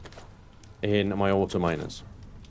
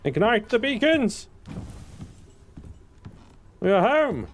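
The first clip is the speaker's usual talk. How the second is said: mock epic proclamation; 10 semitones higher than usual. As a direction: announce it like an epic hero. Grand announcement.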